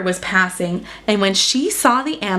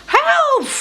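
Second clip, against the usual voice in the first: cry for help. Acted. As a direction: call out in sudden panic. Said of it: "cried Enormous Elephant".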